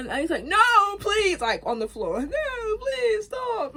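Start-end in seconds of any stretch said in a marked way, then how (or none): 0.5-1.4 high, loud acted plea; hammed-up desperate cry; begging. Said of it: "he's begging now". 2.2-3.8 very high mock-crying plea; hammed-up tearful begging. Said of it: "he's begging now".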